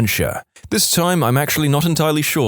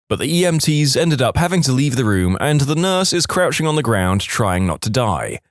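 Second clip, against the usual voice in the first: plain reading voice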